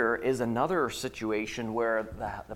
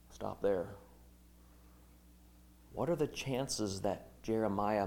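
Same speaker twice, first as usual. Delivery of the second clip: quiet reflection; 4 semitones lower, 10 dB quieter than usual. Real, not acted. Quiet reflective sermon aside.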